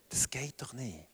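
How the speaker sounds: hushed disbelieving whisper; whispered doubt. Retold or imitated. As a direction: breathe it as a doubtful aside. Disciples' doubting thought.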